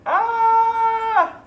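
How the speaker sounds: high shrill startled cry; startled yelp. Pretend. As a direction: yelp in sudden surprise. Sees reflection flip.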